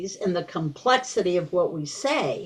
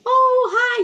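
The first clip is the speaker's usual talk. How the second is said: put-on much higher, sing-song greeting to a baby; 5 dB louder than usual. Shown as a demonstration. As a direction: coo it up high at a baby. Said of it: "you use that different voice, uh, higher pitch".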